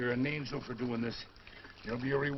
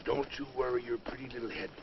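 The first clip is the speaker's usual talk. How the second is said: normal talking voice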